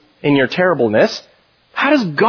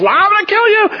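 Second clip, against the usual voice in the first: much higher, half-shouted threat; mock angry God. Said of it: "He's up in heaven going like this".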